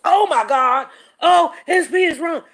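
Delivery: put-on shock, voice pushed up; mock outrage. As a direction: imitate scandalised critics. Mimics people's overreaction.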